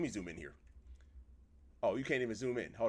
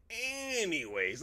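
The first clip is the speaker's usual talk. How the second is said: long, high-starting slide downward; drawn-out segue; 6 semitones higher, much slower than usual. Real, not acted. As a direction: stretch the first vowel to change subject. Moving on after shock.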